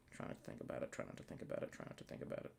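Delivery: repeated whisper to self; fast whispered calming chant; whispered mantra. Real, not acted. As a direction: soothe yourself under your breath. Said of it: "whispered self-calming mantra".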